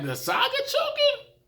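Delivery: high, shocked squeak of disbelief; can't believe what he's seeing; stunned disbelief. Retold or imitated. Fan reacting as the favourite chokes, high shocked disbelief. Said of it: "I'm like".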